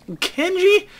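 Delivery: a squeaky disbelieving echo; mock surprise. Real, not acted. Repeats the boss's name.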